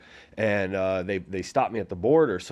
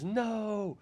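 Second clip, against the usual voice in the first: a high, startled cry of no; panicked no; 12 semitones higher, 9 dB quieter than usual. Retold or imitated. Woman shouting at the man to stop throwing her ex, high and alarmed. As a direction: cry out to stop him, pitched much higher. Girl cries out, stopping the fight.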